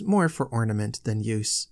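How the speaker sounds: usual speaking voice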